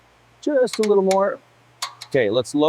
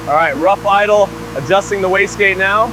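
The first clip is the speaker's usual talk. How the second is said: raised over engine; 5 semitones higher, 7 dB louder than usual. Real, not acted. Calling over revving engine.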